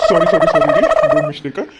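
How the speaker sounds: loud rapid-fire sorries; flustered apology. Acted. Man caught holding the wrong hand, flustered apology. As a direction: blurt it out loudly, flustered.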